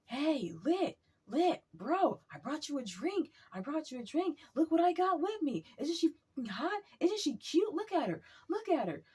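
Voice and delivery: high, eager hype-man voice; imitating excited guy. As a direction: show her off proudly in a high imitated voice.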